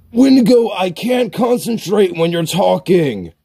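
frustrated gamer outburst; exasperated. Acted. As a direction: yell the name, then keep complaining up high. Scolds friend for talking during play.

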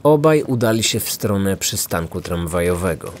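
usual speaking voice

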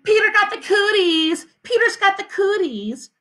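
high sing-out schoolyard yell; taunting yell. A narrator's reading. Sheila yells a cooties taunt at Peter, high taunting yell. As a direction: yell it high like a teasing girl. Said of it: "she yells".

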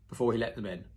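ordinary delivery

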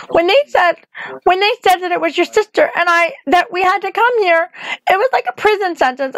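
put-on a frightened child explaining in a rush; young Dorothy pouring it out. Dorothy confesses to the witch, high earnest girl's voice.

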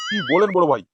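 ordinary delivery

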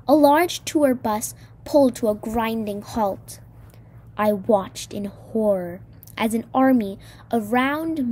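plain reading voice

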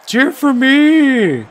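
high, loud, slow call to the crowd; milk the crowd like a wrestler; hammed-up crowd call. Playing to the crowd.

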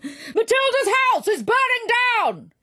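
high, loud alarm cry; high, loud firemen's cry; alarm shout. Acted. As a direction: shout it through the streets.

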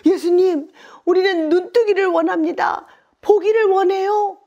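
high, loud, unhesitating plea; bold loud declaration. Acted. Blind men telling Jesus their wish, bold high shout. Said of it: "아주 거침없이 큰 소리로".

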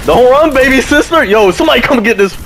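excited shouting. Real, not acted. Laughing taunts at enemy player.

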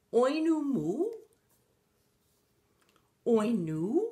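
loud "Oinu" with an oo ending in place of the k; struggling pig stretching oink into "Oinu"; botched oink. A narrator's reading. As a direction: push out "Oinu moo", swooping up at the end. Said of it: "the pig that went moo tried".

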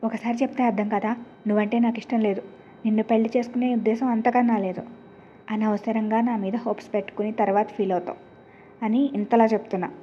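plain reading voice

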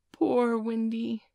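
drawn out in tender pity; awed boy pities the weary bird. A narrator's reading. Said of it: "Nibs said, awestruck".

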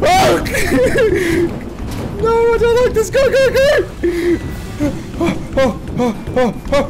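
very high panicked shrieking; being chased. Real, not acted. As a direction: squeal while running for your life. Fleeing Huggy Wuggy.

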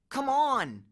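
high, insistent urging; urgent plea. A narrator's reading. Grover tugging at Percy's shirt, urgent. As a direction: hurry your friend away. Grover urges them to move on.

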